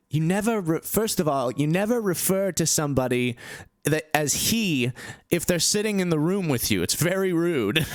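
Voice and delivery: high-pitched mock reprimand; put-on high voice, telling someone off; Russell Brand scolding. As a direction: imitate an indignant lesson in manners. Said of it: "he was like".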